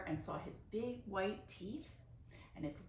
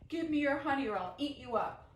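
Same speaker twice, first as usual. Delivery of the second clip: loud bear voice, big roar; 6 semitones higher, 7 dB louder than usual. A narrator's reading. The bear threatens Grandma, loud roar. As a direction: roar it to scare her. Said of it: "roared and said to her".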